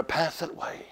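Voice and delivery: whispered, breath only; sudden whisper. Acted. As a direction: drop to a whisper for drama. Dramatic hush in sermon.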